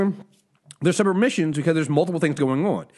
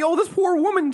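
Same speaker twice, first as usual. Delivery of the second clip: mock pity; 11 semitones higher than usual. Sympathizing with TV character.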